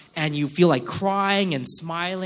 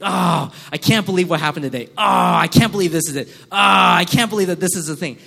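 louder, fed-up griping; exasperated complaining; 8 dB louder than usual. Pretend. A churchgoer griping loudly about how the day went. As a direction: vent like a day gone wrong.

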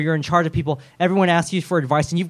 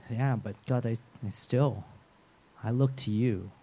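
Job's quiet prayer; 6 semitones lower, 10 dB quieter than usual. Acted. Job still turning to God.

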